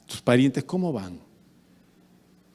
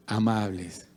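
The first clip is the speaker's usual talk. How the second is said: normal talking voice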